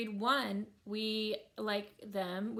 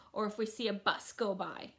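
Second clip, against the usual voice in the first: usual speaking voice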